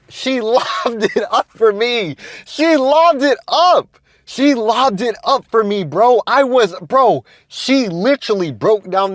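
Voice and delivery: high, slow, stretched-out exasperation; exasperated disbelief. Real, not acted. Telling viewers how she set him up, high and drawn out in disbelief. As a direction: drag it out, can't believe he blew it.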